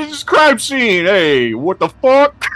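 high, loud warning; angry cop. Acted. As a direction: yell at trespassers, slow. Cop blocks crime scene.

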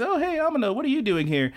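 high, lilting greeting; Misumi. Retold or imitated. Classmate asking what he is doing here, high-pitched. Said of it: "he says".

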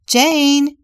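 loud high call from another room; mom calling out. Acted. Mother calling Jane down from another room, loud high call. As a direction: call her name up the stairs, loud. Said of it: "a woman's voice came from another room".

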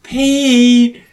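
loud, high, drawn-out call. Retold or imitated. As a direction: holler the name up the stairs. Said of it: "when they shout up to Pete".

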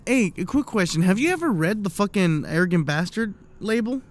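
usual speaking voice

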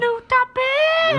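high, drawn-out hype shout; excited announcement. Acted. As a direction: belt it out like a segment jingle. Hyped shout announcing new segment.

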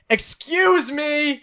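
high, drawn-out call. Retold or imitated. Reader acting gym guy calling to girl ahead, high drawn-out call. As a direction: stretch it out to catch her attention. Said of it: "I call to her and say".

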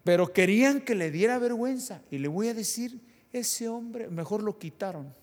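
high, loud pulpit outrage; a burst of indignant preaching. Acted. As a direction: rise up and scold the congregation.

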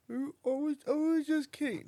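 put-on small, high, soft child voice; imitating a pleading kid. Parent mimicking a scolded kid backing down, high kid voice. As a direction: squeak out a kid's meek excuse.

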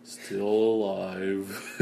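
slow, drawn-out gloomy donkey impression; Eeyore. Gloomy storm-cloud donkey asked how he is, mock mournful. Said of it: "poor Eeyore. That's me".

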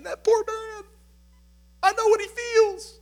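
high, choked-up imitation of himself; moved inner voice. Preacher retelling crying at Ratatouille, high tearful imitation. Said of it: "I'm thinking".

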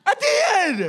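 put-on high, far too loud kid voice; mock little boy insisting at full volume; loud child protesting. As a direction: imitate a kid loudly defending himself. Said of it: "just a really loud dude".

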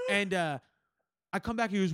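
normal talking voice

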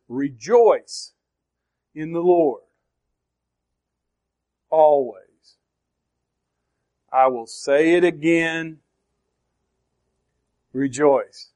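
spaced out, weight on "Rejoice" and "always"; emphatic. Shown as a demonstration. Preacher reads the verse to show its emphasis, emphatic. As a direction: give "Rejoice", "always" and the final "rejoice" each a separate, weighty beat. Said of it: "listen to how he emphasizes".

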